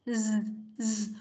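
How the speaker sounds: quiet buzzing "Z", throat vibrating; voiced z. Shown as a demonstration. Teacher showing how z vibrates in the throat. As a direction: hum "Z" in the throat. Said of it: "cái hơi đi qua cổ là con phải rung".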